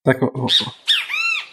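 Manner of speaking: big real sneeze. Real, not acted. Sneeze after warning.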